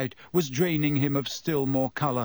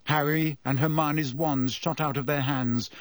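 ordinary delivery, nothing marked

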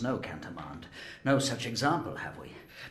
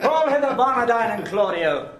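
calling out; 13 semitones higher, 12 dB louder than usual. Acted. Provost calling out loudly.

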